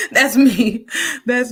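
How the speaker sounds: real laugh, high and loud. Real, not acted. Recognizing herself in the teacher role, laughing. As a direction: laugh it out at yourself.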